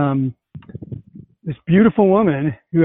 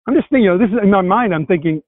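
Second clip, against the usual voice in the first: ordinary delivery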